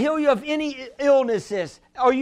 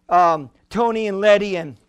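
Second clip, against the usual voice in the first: ordinary delivery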